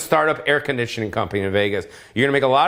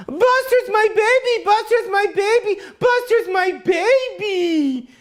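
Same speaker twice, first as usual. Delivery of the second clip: yelling to the dog; slower than usual. Retold or imitated. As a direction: shout it like a crazy dog owner. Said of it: "I yell out".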